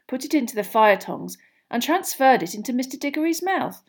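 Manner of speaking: ordinary delivery, nothing marked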